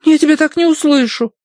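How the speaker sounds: put-on high, loud pouting child; sulky little girl. A narrator's reading. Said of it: "снова надулась Агнес".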